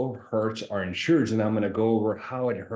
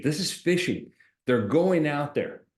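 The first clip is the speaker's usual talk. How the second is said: usual speaking voice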